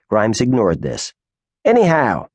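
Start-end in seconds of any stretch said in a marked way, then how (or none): 1.6-2.3 higher, pleased with himself; smug lead-in. Acted. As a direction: savour your little victory. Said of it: "he said smugly".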